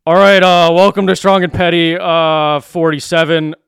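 mock a bored podcast host opening the show; Tyler impression. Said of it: "this is my Tyler impression".

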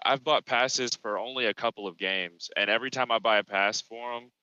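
usual speaking voice

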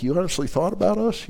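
soft, high, amazed question; touched disbelief. Retold or imitated. Couple receiving chocolates from her, amazed. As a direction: ask it with hushed, rising wonder.

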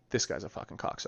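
whispered. Retold or imitated. Retold thought about new friend.

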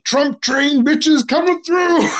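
high, drawn-out whooping call; mock hollering. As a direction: holler it like a hype man, stretching the words. Acting out a gleeful provoking reply.

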